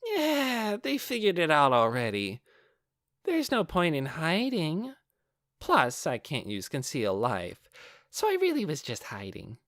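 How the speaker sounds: put-on high, teasing young woman's voice; bright, mocking sing-song delight; a high, teasing, toying voice; playful Clementine.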